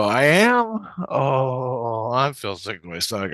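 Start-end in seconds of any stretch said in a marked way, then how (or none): 0.0-0.9 high, loud rising "am"; astonished question. Retold or imitated. Man learns he slept there, astonished. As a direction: retell it as startled disbelief. Retold shock at room news.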